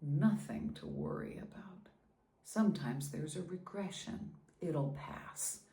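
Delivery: hushed, bitter imitation of the doctor's reassurance; whispered doctor quote. Mother quietly quoting the doctor's empty reassurance, whispered. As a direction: softly mimic the doctor's brush-off. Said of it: "and he said".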